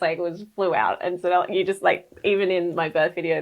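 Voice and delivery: ordinary talk, nothing marked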